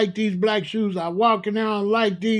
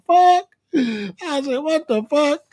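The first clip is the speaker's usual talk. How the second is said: outraged disbelief; 7 semitones higher, 3 dB louder, slower than usual. Retold or imitated. Outraged retold reaction.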